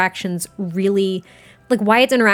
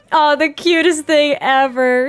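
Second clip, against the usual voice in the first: high, slow, gushing "aww" voice; gushing squeal; 6 semitones higher, 4 dB louder, slower than usual. Real, not acted. Player gushing at the father hugging his daughter. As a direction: melt over something adorable.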